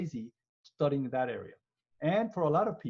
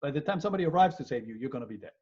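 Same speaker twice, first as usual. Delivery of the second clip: normal talking voice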